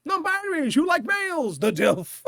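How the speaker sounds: high, projected MC call; mock announcer. Barista addressing non-binaries who like males, high announcer call. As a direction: sing out the introduction to a hall.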